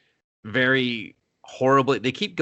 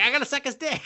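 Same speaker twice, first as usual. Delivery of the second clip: squeaky laughing falsetto; laughing high mock voice; faster than usual. Imitating the wife at the wedding, high laughing voice. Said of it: "she's like".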